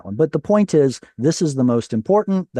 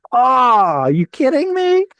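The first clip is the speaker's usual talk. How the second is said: high, loud squawk of outrage; mock disbelief; 11 semitones higher, 4 dB louder than usual. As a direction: exclaim way up high, hammed-up incredulity. Teacher's mock reaction to the rule.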